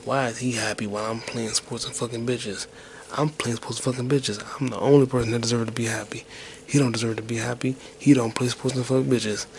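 ordinary talk, nothing marked

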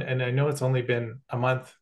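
ordinary talk

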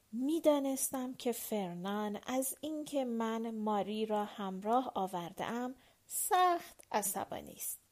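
ordinary delivery

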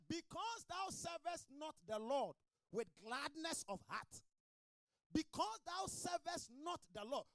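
slow, high declaimed verse; forceful scripture reading. Real, not acted. Preacher reads warning from Deuteronomy to church, forceful.

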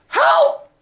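very high, loud acted cry; piercing storyteller's yell for rescue; shout for help. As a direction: shout out as if stuck and sinking. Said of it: "And he shouts".

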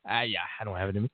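usual speaking voice

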